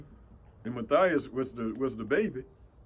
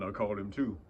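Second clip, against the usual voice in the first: ordinary delivery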